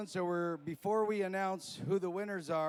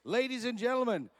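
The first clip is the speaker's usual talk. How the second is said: loud, high crowd-pumping hype; hype announcer; 5 semitones higher, 6 dB louder than usual. Acted. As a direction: rile up the crowd, bigger each call. Hype build-up.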